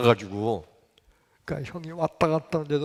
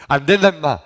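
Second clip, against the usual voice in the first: loud, curt acted refusal; mock-stern shout; gruff refusal; 3 semitones higher, 11 dB louder than usual. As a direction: refuse loudly, like an older boy to a kid. Older boy refusing the child.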